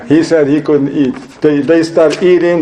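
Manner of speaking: normal talking voice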